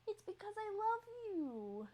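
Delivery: high, cooing protest of love; high-pitched affectionate whine. Real, not acted. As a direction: plead sweetly with a pet. Pleading affection to cat.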